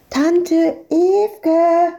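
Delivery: put-on high, excited child's call; shouting child. A narrator's reading. Madita calling her aunt across the beach, shouting. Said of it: "Schreiend und winkend kam Madita".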